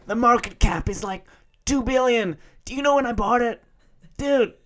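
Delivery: loud, high, strangled American dude voice; mock an overexcited American crypto bro; American bro accent impression. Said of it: "sounds like a, a, a kid".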